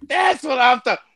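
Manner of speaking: high-pitched excited shout; reenacted shout of approval; fanboy cheer. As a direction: cheer it out like a thrilled fan. Fan reliving his cheer.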